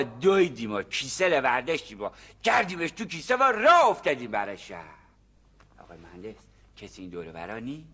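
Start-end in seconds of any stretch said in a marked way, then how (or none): 5.8-7.8 much lower, much softer secret question; conspiratorial hush. Acted. Farmer asks the engineer if anyone can hear, hushed. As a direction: lean in and check nobody's listening. Checking nobody overhears.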